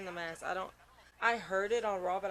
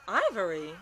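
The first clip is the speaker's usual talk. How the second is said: louder falling dismayed cry; loud disbelief; 8 dB louder than usual. Real, not acted. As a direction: blurt the name out in dismay. Shocked at surprise entrant.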